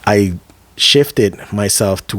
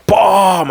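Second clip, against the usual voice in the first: high, punched boom; sudden exclaimed boom; imitated crash; 11 semitones higher, 3 dB louder than usual. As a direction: voice the impact with the door. Imitates hitting the door.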